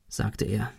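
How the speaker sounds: usual speaking voice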